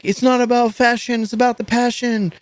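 high, chant-like band motto; mock hardcore slogan. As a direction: preach it like an old hardcore band. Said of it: "where they're like".

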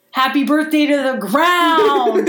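high, slow, drawn-out mock cry; triumphant send-off. Throwing the ring in the garbage, triumphant send-off. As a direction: stretch it out as you fling it. Acts out throwing it across the room.

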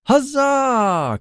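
very high, drawn-out cheer; cried cheer. A narrator's reading. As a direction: echo the knight's cheer in a high cry. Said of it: "cried the ranger".

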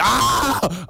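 short high mock yelp of pain; mock scream. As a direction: yelp as if a wart was touched. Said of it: "I scream".

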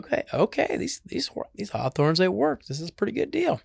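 high-pitched, pleased realisation; pleasantly surprised porcupine. Acted. Porcupine realising his hawthorns work, pleasantly surprised. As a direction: lift it high, lightly acted discovery.

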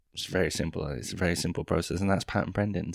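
ordinary talk, nothing marked

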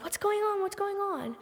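high, frightened repeated question; retold panic. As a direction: squeak it out like a scared kid at night. Scared girl hears a break-in.

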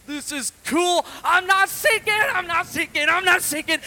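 gleeful, amazed; thrilled Peter. Acted. Peter walking on water, loud and gleeful. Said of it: "maybe Peter thought".

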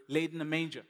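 ordinary talk

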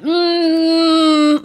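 long, high, loud "mm"; sustained doubtful hum; disapproving hum. Retold or imitated. Listener reacting to a bad English accent, long high hum.

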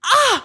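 high loud cry of pain; pained scream. Acted. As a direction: scream as it burns. Wax in ear.

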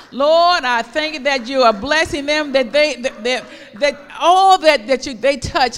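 loud, high, fervent prayer. Shown as a demonstration. Preacher models a loud prayer over children. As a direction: pray it out boldly over the children.

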